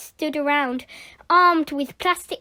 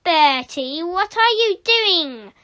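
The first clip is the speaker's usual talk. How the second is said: loud, rising teacher's bellow; stern, high-voiced demand, drawn out; thundering; 5 semitones higher, 5 dB louder than usual. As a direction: thunder at the culprit. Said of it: "thundered Miss Boot".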